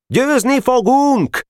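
high, drawn-out cheer; boys' triumphant battle cry; rallying cry. A narrator's reading.

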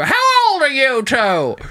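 put-on high, slow old lady question; mock nosy lady. Old lady asks the couple their age, high and slow. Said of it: "she just go".

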